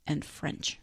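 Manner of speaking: soft unvoiced joke tossed off; teasing whispered aside. Real, not acted. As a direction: drop to a whisper for the punchline. Wry aside after description.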